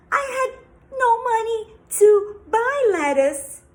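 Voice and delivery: frightened pleading. Acted. Father pleading with witch.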